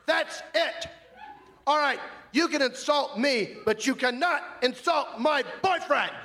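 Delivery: high-pitched indignant outburst. Retold or imitated. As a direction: shriek it, standing up to the bully. Stands up, shouts at heckler.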